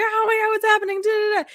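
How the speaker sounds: very high-pitched panicked squeal; squealed, hammed-up scream; mock screaming. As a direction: imitate a group screaming, trailing into nonsense syllables. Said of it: "they scream and they're like".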